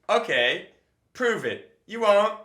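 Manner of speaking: teasing crowd challenge. Pretend. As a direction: dare the crowd playfully. Stand-up bit rerun.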